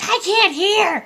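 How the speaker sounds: put-on high, loud, drawn-out kid's whine; son complaining. Son complaining over mom's screaming, imitated protest. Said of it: "My son's like".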